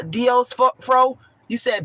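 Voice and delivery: usual speaking voice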